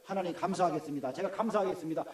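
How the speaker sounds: soft, low prayer of resolve; quiet prayer. Retold or imitated. Pastor recalling how he prayed through his anger, softly. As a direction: murmur a vow of thanks under the breath. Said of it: "라고 계속 기도하면서".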